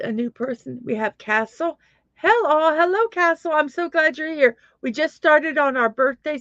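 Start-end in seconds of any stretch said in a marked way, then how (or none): 2.1-4.6 excited greeting. Real, not acted. Greeting new viewer by name.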